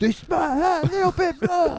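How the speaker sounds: hammed-up Cockney dentist; thick mock-Cockney impression. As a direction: mimic it back in exaggerated Cockney. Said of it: "particularly Cockney accent".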